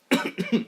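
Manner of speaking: short harsh cough. Real, not acted. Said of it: "I'm choking on cigarettes".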